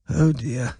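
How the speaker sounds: put-on a faint, frail old man's sigh; mildly feeble dismay; weak old man. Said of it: "said Sir Kevin weakly".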